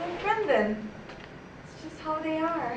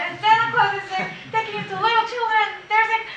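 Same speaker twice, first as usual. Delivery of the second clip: building from raised voice to loud, higher pitch; agitated dream retelling; 3 semitones higher, 6 dB louder than usual. Acted. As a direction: get more and more worked up as the nightmare escalates. Frantic retelling of nightmare, building.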